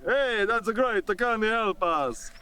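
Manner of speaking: slow, sing-song mock Italian; put-on Italian, vowels drawn long; Italian accent. Shown as a demonstration. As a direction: stretch it out in a hammed-up Italian accent. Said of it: "Uh, Italian!".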